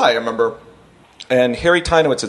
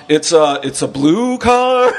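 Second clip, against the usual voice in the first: clueless caller; much slower than usual. Retold or imitated. Clueless caller describing car to 911.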